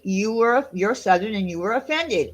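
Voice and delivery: ordinary talk, nothing marked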